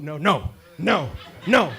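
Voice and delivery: loud, drawn-out mock refusals; aggressive refusal. Still in Cairo mode among peaceful vendors, mock aggressive. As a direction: act out a stubborn string of refusals. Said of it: "I'm already in my mode".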